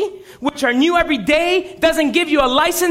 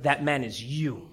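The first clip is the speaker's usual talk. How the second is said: grave accusation; 11 semitones lower, 10 dB quieter than usual. Acted. Prophet confronts David.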